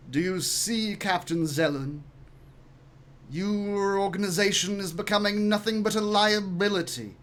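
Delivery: lightly posh, disdainful speaker; a touch of posh hauteur. Acted. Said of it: "This guy's so posh".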